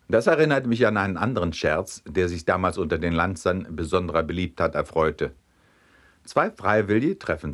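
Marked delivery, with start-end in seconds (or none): none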